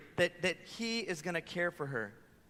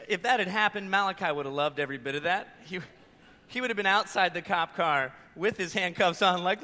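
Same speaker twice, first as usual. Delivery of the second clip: usual speaking voice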